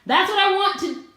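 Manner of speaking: high, loud burst of excitement; excited. Real, not acted. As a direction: gush about it. Excited about the look.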